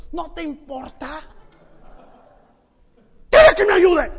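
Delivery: rising into a furious yell; a sister yelling that she's left to work alone; angry shout. Retold or imitated. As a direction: shout your complaint at him. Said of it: "el tono de Marta gritándole a Jesús".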